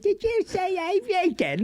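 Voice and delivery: very high, slow cheeky-monkey English voice; botched English accent. Shown as a demonstration. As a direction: overdo a squeaky, badly botched English accent. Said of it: "I totally botched the accent".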